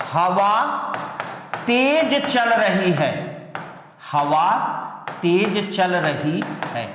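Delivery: drawn-out words with long pauses; stretched, deliberate example sentence; slow dictation. Shown as a demonstration. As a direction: read it slowly, piece by piece, for note-takers. Teacher dictates example sentence slowly.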